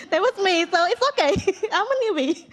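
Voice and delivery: high, loud, laughing through the words; laughing admission. Real, not acted. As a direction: laugh at yourself, voice jumping up. Laughing admission of being new.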